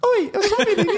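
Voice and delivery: put-on high squeaky little girl voice. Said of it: "Mas a menina".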